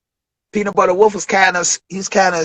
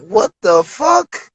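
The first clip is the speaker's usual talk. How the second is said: stunned disbelief; 11 semitones higher, slower than usual. Retold or imitated. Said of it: "I'm like".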